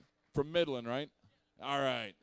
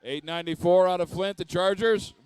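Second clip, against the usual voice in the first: game announcer; 3 semitones higher, 15 dB louder than usual. Real, not acted. Announcing picked team to crowd.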